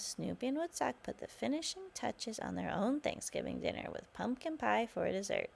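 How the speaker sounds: ordinary delivery, nothing marked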